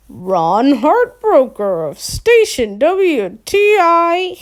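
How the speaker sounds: slow, drawn-out game show announcer; hammy radio host. Acted. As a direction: stretch it out like a prize reveal. Fake host answers who.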